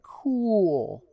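high, drawn-out awestruck voice; mock boyish awe. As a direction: marvel like an impressed kid. Mimics boy Max's naive awe.

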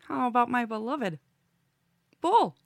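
showman intro. Acted. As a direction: build up the name like a ringmaster. Host introduces a guest bit.

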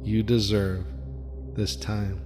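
ordinary talk

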